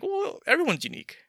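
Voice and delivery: high-pitched mock retort, jumping up on "Well"; imitated objection. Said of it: "someone else would be like".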